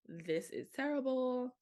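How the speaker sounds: high, loud, drawn-out mock wail; acted dismay. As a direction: stretch it into a comic lament. Acts out her second thoughts.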